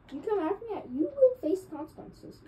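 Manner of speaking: mock threat. Real, not acted. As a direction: gloat over the kill. Taunting a beaten enemy.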